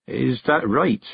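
ordinary delivery, nothing marked

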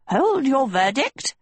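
high, swooping gnome voice; Yuba the gnome, admonishing. A narrator's reading. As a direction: halt them in a piping, odd gnome voice.

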